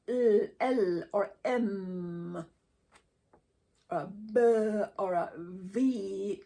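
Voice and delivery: slow hummed L, M, B and V with the voice on; voiced consonants. Shown as a demonstration. Teacher showing the class consonants voiced through the vocal cords. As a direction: draw the sounds out slowly so the voice buzzes. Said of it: "L sound comes with a, uh, vocal cord".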